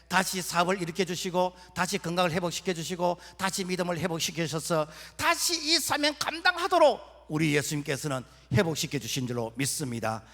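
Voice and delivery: usual speaking voice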